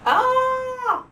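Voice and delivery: high loud yelp; startled cry. Acted. Frog lands on alligator, high startled yelp. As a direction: caught off guard. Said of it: "says the frog".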